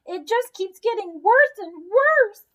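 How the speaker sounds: high, tearful wail; near sobbing. A narrator's reading. Mother near tears over her worsening child. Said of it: "And she began to sob".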